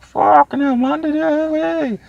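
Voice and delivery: put-on very high, slow, slurring drunken exclamation; drunk relative. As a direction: ham up a tipsy in-law. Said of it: "it was like".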